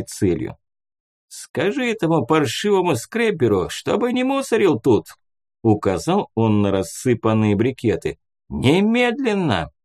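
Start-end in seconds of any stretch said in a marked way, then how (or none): 1.3-5.1 put-on high, whiny grumbling old man; a cranky old ghost nags. A narrator's reading. Ghost orders narrator. 8.5-9.7 high, slow bossy command; demanding old ghost. A narrator's reading.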